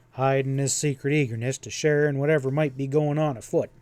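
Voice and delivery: ordinary delivery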